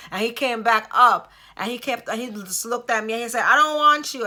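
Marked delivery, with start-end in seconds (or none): 3.4-4.3 higher, louder, cold "I don't want you"; cold rejection. Retold or imitated. Husband rejecting his wife after Jamaica, loud and cold. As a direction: fling it at her like a stranger. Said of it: "that didn't even sound like him".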